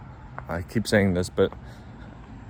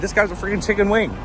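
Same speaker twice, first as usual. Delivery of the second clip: dismissive mocking; 6 semitones higher than usual. Imagined onlookers mocking a pushover.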